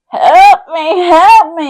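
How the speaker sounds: high, loud crying plea; hammed-up cries of a trapped mother; mock cry for help. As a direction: wail for help from under a door. Mom trapped under the door.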